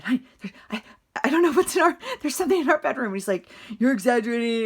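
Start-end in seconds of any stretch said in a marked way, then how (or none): 0.0-3.1 frightened. Retold or imitated. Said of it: "I said". 3.8-4.6 annoyed. Retold or imitated. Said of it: "he was just frustrated".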